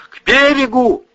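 loud high shout over the storm. A narrator's reading. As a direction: yell it out across the waves. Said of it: "крикнул Витька".

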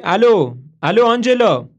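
loud, high calling down the line. Acted. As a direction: shout her name into the phone. Calling into dead phone.

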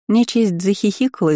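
plain reading voice

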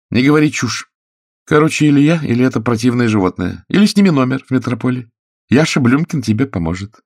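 high, loud, insistent woman's voice; irritated actress. A narrator's reading. Actress tells Oleg to choose her or the cat, irritated. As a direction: lay down an ultimatum.

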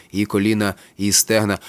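usual speaking voice

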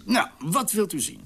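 ordinary talk

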